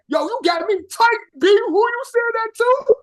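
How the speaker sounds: high-pitched put-on New York indignation; mock mad New Yorker. Demonstrating what New Yorkers say when mad, mock angry. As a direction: act offended in a fake New York accent. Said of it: "What y'all say when y'all mad?".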